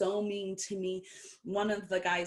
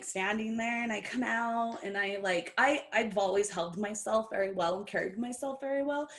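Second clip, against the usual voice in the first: normal talking voice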